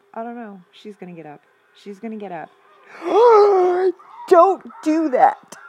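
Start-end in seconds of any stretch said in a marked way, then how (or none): 2.9-4.2 loud, sharp gasp of fright; shocked gasp. Real, not acted. Shock as monster gets up. 4.3-5.6 loud, slow, high pleading yell; frantic shout. Real, not acted. Screaming at game character.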